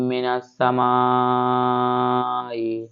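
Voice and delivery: মিনাস সামাই with মা drawn long; long madd. Shown as a demonstration. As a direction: stretch the madd on সামা. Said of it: "মাটা সাহেব টেনে পড়তে হবে". (মাদ টেনে ধীরে পড়া)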